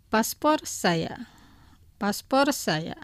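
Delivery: very slow, careful "paspor saya"; slow model phrase. Shown as a demonstration. Radio teacher explaining the noun to listeners, very slowly. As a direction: stretch it out so learners catch each syllable. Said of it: "Los pronunciaré dos veces".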